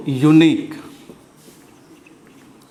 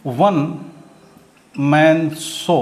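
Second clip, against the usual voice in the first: slow demo word; 3 semitones lower, much slower than usual. Shown as a demonstration. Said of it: "फिर देखो आप कहते हो".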